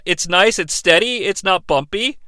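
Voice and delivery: usual speaking voice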